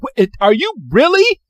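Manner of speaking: mock incredulous outburst. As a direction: act stunned and offended. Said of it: "And he's like".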